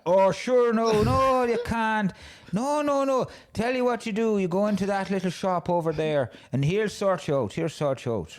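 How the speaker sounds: high, loud imitated Irish bus driver accent. Retelling the Dublin bus driver, in a hammed-up high Irish accent. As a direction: play the cheery Irish driver, pitched well up. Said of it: "I'm not gonna do the accent".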